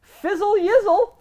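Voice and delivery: high, loud, slow mock British; British tour guide. Tour guide mocking idiots with slang, high slow British put-on. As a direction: drag out the slang in a posh mock voice. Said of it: "he goes".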